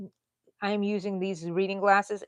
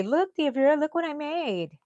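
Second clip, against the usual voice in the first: high-pitched, proud call to an aunt; imitated eager showing-off; 8 semitones higher, 4 dB louder than usual. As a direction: call her over to admire your work. Retells showing sewing to her aunt.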